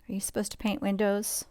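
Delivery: usual speaking voice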